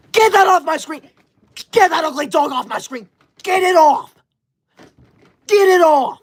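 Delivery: loud high frustrated yelling; shouting in frustration. Real, not acted. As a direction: yell at the chat to remove it. Gift covering her screen.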